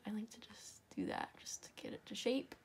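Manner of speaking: whispered under her breath to herself; a soft unvoiced aside while working. Real, not acted. Aside while shaping the leg.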